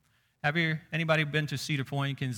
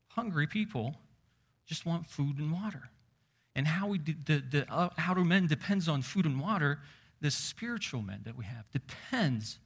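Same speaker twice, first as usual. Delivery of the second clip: ordinary talk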